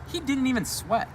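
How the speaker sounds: ordinary delivery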